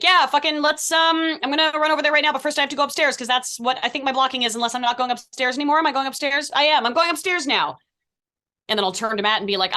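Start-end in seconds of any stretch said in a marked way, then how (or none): 0.0-7.8 higher, louder, rambling and fast; flustered actress unsure of her blocking; imitating Kat. Actor imitating castmate Kat lost in her blocking, higher and rushed. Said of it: "And then Kat will be like".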